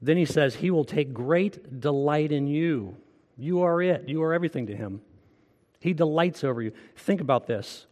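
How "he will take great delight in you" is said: slow, deliberate reading of the verse; slow scripture reading. Shown as a demonstration. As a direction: let each word of scripture land, slowly. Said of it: "I wanna read it slow".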